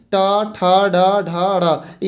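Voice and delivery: ड, ढ, ढ़ उच्चारण करके धीरे-धीरे; हर अक्षर अलग-अलग, बहुत धीरे; slow letter reading. Shown as a demonstration. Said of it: "इसमें आएगा". (छात्रों को अक्षर धीरे बोलकर सिखाते हुए)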